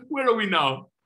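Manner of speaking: laughing through the question; amused, rhetorical. Real, not acted.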